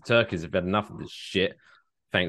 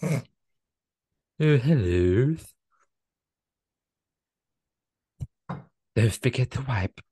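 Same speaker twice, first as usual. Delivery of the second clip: lisping snake voice. Pretend. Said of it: "speaks with a lisp".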